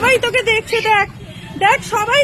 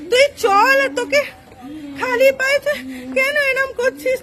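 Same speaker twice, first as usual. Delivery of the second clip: normal talking voice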